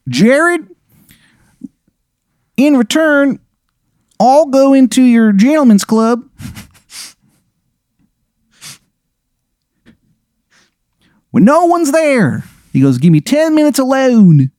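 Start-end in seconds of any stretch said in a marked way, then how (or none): 0.1-12.4 much higher, hammed-up awful Maryland accent; terrible Maryland accent. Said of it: "the worst Maryland accent you have ever heard". 13.0-14.5 much higher, hammed-up awful Maryland accent; terrible Maryland accent. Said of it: "the worst Maryland accent you have ever heard".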